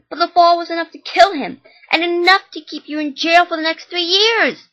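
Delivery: loud, high furious rant; furious mom. Acted. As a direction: rage at him for the boy's death. Mom yells at jailed son.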